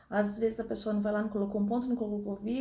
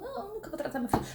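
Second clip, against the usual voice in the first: mocking the naive child; 10 semitones higher, much faster than usual. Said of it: "Passa a vida inteira falando".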